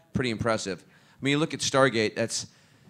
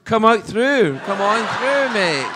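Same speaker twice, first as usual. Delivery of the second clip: high, loud imitated guard; eager welcome; 11 semitones higher, 10 dB louder, slower than usual. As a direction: call out an over-eager welcome to a star. Guard eagerly waves the star in.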